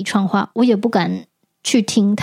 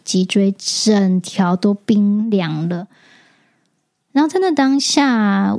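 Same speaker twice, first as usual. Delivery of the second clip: ordinary delivery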